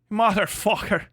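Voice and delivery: put-on Irish-accented swearing, R sounded; imitation Irish lilt on a curse; mock Irish accent. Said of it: "the Irish say motherfucker beautifully".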